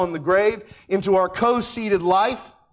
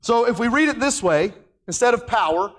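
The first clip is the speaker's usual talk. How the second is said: ordinary delivery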